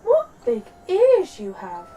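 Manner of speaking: put-on high, loud and slow little-girl wonder; astonished storybook child; gasping in amazement. A narrator's reading. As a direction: stretch it out, amazed, with big pitch swoops. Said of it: "she gasped".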